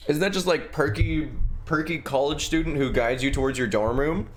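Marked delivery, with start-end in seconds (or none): none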